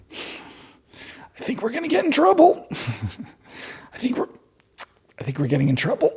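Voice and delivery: high, hushed, fretful mock voice; mock nervous worry. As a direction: play them as scared kids whispering. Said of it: "They're like".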